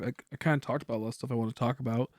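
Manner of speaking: ordinary delivery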